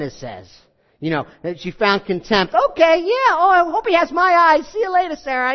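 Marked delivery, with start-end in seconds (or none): 2.5-5.5 high, smug put-on girl's voice; sing-song gloat, rubbing it in; taunting Hagar. Preacher mimics Hagar waving goodbye to Sarai, high and gloating.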